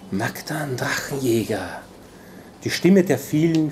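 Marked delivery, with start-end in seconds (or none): 0.1-1.8 slow, softer whisper from many voices; a crowd of shadows greeting the dragon hunter in a whisper; layered whispering chorus. A narrator's reading. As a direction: whisper his name like a chorus of shades. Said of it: "Flüsternd und mehrschichtig".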